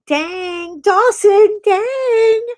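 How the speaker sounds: high, loud delighted squeal at a donation; excited squeal. Real, not acted. Streamer squealing at Dawson's donation, thrilled. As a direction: squeal it out with joy.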